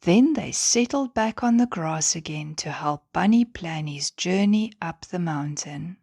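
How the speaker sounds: usual speaking voice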